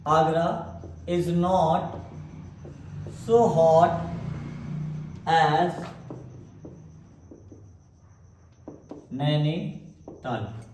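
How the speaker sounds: very slow, word by word, for copying; slow dictation. Shown as a demonstration. Teacher dictating the example to the class, very slowly. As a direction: spell it out so students can write it.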